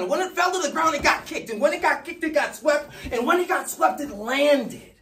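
high-pitched, loud, breathless-paced run-on rant; frantic shouting. Acted. Speaker frantically retraces the lost note for Jack, shouting high.

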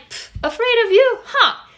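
high, scornful scoff back at the thug; mocking, fearless retort; defiant scoff. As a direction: toss it off like they can't scare you. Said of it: "And I'm like".

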